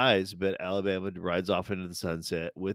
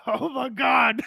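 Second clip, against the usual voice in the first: much higher, louder gasped exclamation; overwhelmed; 6 dB louder, slower than usual. Retold or imitated. Moved viewer reacting to the airport scene, high overwhelmed cry. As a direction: cry it out, overcome.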